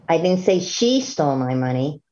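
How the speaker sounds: single out she as the key word; stress on she. Shown as a demonstration. Lecturer showing how tone changes the message, stress on she. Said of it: "listen to it like this".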